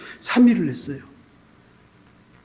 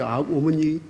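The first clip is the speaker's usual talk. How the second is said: usual speaking voice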